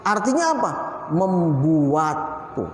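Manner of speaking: normal talking voice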